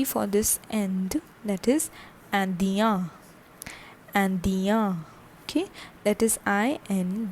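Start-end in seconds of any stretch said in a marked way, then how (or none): 2.3-5.0 "Indien" as an-dya, open nasal ending; masculine French pronunciation. Shown as a demonstration. Teacher modelling the masculine nationality, "Indien" ending in a nasal ya. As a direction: say "Indien" with no final n sounded. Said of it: "the pronunciation is Ian".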